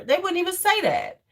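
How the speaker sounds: ordinary talk, nothing marked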